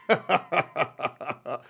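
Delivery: rapid chuckling burst; laugh. Real, not acted. Owner amused by his cat, staccato laugh. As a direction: laugh in a quick staccato run.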